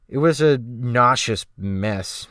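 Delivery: usual speaking voice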